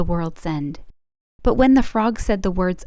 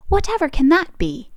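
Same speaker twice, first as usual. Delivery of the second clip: higher and louder, surprised; startled cry; 8 semitones higher, 4 dB louder than usual. A narrator's reading. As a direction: stepmother startled by the knock. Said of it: "cried out the stepmother".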